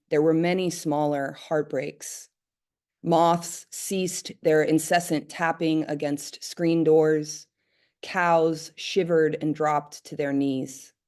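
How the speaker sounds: measured, deliberate poem recital; poetry reading. Acted. Poet reading an apocalypse poem to an audience, measured and deliberate. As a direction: read each image slowly and firmly, pausing between lines. Reads own poem aloud.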